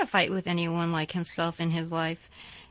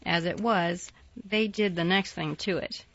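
usual speaking voice

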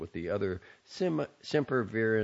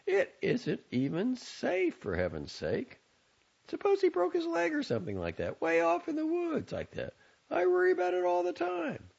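mother fretting about her son without a phone. A narrator's reading. Mother worrying.

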